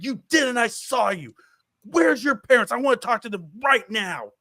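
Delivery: high-pitched, insistent confrontation; angry yelling. Retold or imitated. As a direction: re-enact an irate dad demanding answers. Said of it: "Stop yelling at him".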